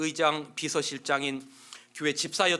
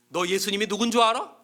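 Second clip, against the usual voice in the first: loud, higher challenging question; gruff quizzing; 5 semitones higher, 6 dB louder than usual. Acted. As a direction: test him roughly. Inmate quizzing another prisoner.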